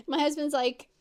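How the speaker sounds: normal talking voice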